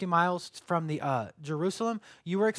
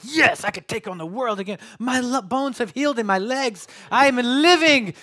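rising to a loud, high, slowed cry of joy; triumphant cry; 6 semitones higher, 8 dB louder than usual. Retold or imitated. Runner revived by honey, crying out loud in triumph. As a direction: shout it like you could take on the world. Retold burst of energy after honey.

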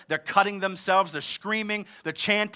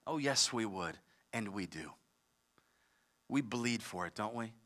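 much softer, lower admission; quiet confession; 9 semitones lower, 13 dB quieter than usual. Real, not acted. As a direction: drop the voice to a hushed confession. Preacher drops to confession.

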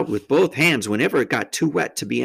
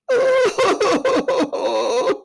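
high, loud boo-hoo; hammed-up bawl, shuddering; wailing sob; 5 dB louder than usual. A narrator's reading. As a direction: howl of misery. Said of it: "between his sobs".

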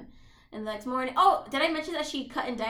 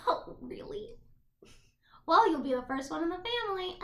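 higher, put-on mom voice; imitated mother; 5 semitones higher than usual. Retelling the mom answering the gangster, put-on high voice. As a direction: lift the pitch into a politely delighted mom.

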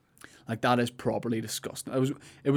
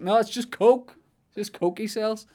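calling back; 10 semitones higher than usual. Retold or imitated. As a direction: call it back brightly, as if clearing things up. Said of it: "I called back to him".

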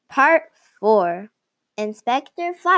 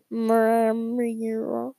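mumbling through a mouthful; mouth full; 3 dB quieter than usual. Acted. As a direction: talk around a full mouth. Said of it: "When your mouth is full".

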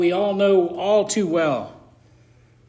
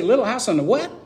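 put-on kids' puzzled question, pitched up; imitating young kids; 6 semitones higher than usual. Kids who never heard of the show, asking puzzled. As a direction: play a baffled kid, rising at the end. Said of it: "'cause they'd say".

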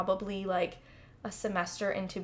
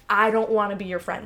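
raised, blunt brush-off; loud blunt rejection; 7 dB louder than usual. Retold or imitated. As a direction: push them away loudly. Quoted rejection hint.

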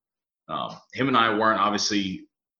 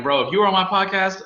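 higher and louder; excited reply; 10 semitones higher, 6 dB louder than usual. Retold or imitated. Host answering his friend, excited and high. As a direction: lift it up, excited.

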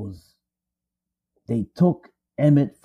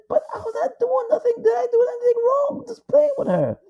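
put-on much higher, crying boy's voice; young Emmett pleading. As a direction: whimper it like a scared, innocent boy. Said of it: "He said".

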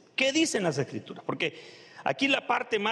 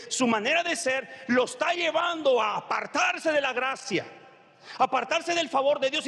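raised, shouting preacher voice; fiery preaching; 4 semitones higher than usual. Acted. Preacher commanding the congregation to cast off anger, shouting. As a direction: preach it hard, rising to a shout.

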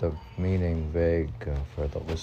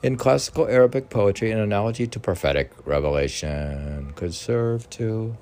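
an animated, declaiming text reading; formal reading; 3 semitones higher, 6 dB louder than usual. Acted. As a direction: read it out with rising energy. Continues reading commentary aloud.

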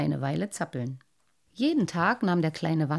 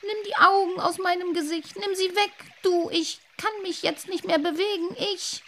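much higher, panicked pleading; terrified plea; 11 semitones higher than usual. Acted. Boy begging the fish in his nightmare, panicked. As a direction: beg in panic.

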